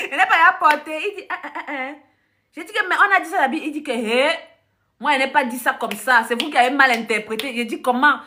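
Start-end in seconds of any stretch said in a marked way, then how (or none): none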